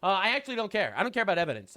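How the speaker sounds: normal talking voice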